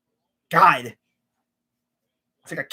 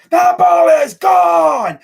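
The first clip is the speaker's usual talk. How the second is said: loud, high, drawn-out home run call; amped-up sportscaster; 9 semitones higher, 9 dB louder, much slower than usual. Acted. Fan mocking Joe Buck when his favorite team is playing, amped up. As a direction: belt it out like an amped-up announcer. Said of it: "he'll get all amped up".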